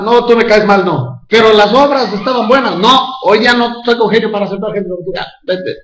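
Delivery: a capricious deity dismissing a soul; Allah at judgment. Pretend. Preacher parodies Allah rejecting a soul at judgment, mock-grand. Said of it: "el rato del juicio dice Alá".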